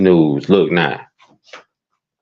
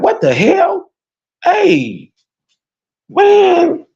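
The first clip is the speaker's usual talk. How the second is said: high, crying-out complaint; exasperated wail; much slower than usual. Real, not acted. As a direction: wail it out in disbelief. Overwhelmed by disturbing news.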